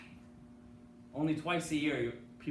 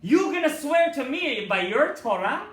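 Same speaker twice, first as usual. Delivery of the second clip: slow, loud, much higher mocking challenge; dibuk's voice; 11 semitones higher, 11 dB louder, slower than usual. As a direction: spit the challenge back at the rabbi. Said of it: "a man's voice comes out of her".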